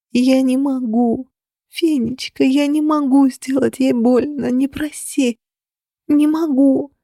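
high, slow, near tears; anguished refusal. A narrator's reading. Heroine begs Phoenix not to ask, near tears. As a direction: plead with a breaking voice.

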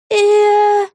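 high, loud, stretched-out questioning sound; long, puzzled, high character voice; drawn-out hesitation. A narrator's reading. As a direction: draw it out, clueless and high-pitched. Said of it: "протянула Вега".

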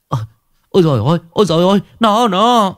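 high, rushed, frightened stammer; flustered villager losing his words; panicked babble. A narrator's reading. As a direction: gasp it out in a fast panic.

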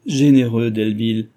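tearful cry. A narrator's reading. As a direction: cry out gratefully, a touch tearful. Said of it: "s'écria Cécile, fondant en larmes".

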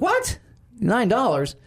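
high, squeaking "What?" then a falling "Nine dollars?"; mock outrage. Hearing the shipping price, high mock outrage. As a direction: play the shocked customer at the counter.

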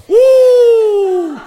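high, loud delighted whoop; a sudden thrilled hoot; long rising-falling whoop of delight; excited whoop. Acted. Man reacts to offer.

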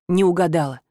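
ordinary delivery, nothing marked

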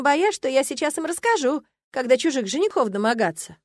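plain reading voice

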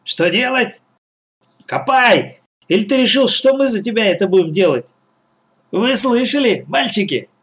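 high-pitched, indignant ordering; bossy scolding. A narrator's reading. Gang boss orders the boy to dig, bossy scolding.

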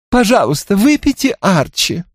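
high begging plea; pleading. A narrator's reading. Belinda begging Archie to drink, high pleading. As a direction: beg him sweetly to drink. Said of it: "взмолилась Белинда".

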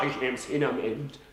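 ordinary delivery

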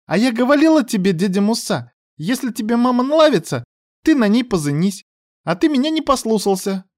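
put-on high, lisping child voice; little girl lecturing an adult; scolding little girl. A narrator's reading.

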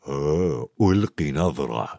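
low, soft dodging mumble; evasive. A narrator's reading. Hagrid caught in the library, low and evasive. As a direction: dodge the question in a low voice. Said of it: "قال لهم بصوت مراوغ لفت نظرهم".

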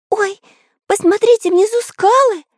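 very high, anxious cry; worried alarm. A narrator's reading. Karandash spots rocks below the falling ship, high worried cry. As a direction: squeal a warning. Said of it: "забеспокоился Карандаш".

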